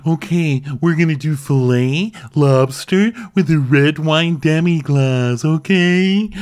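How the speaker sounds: slow on demi-glace; mock chef announcing. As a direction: present the dish like a proud chef. Imitating Matt announcing dish.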